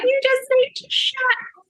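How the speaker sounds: high, laughing shout to shut up; mock angry snap. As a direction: snap at him, half laughing. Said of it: "I'm swearing at my husband to just shut up".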